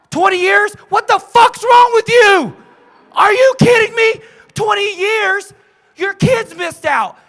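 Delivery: full-force high shouting in outrage; explosive tough-love shout, words stretched; crazy coaching yell. Shown as a demonstration. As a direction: yell to jolt them awake. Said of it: "this is my crazy coaching. Level ten".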